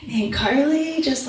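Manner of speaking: ordinary talk, nothing marked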